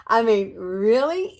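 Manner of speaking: laughing loudly, slowed "really?". Real, not acted. To viewers, delighted by the look, laughing loudly. Delighted by her finished makeup.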